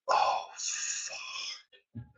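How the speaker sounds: hushed, breathless whisper of dread. Retold or imitated. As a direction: whisper it as the bill arrives. Friend sees the bill.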